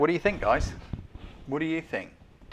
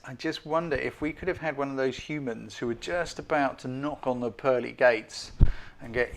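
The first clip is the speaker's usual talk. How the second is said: usual speaking voice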